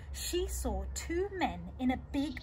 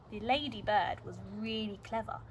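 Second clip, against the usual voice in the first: usual speaking voice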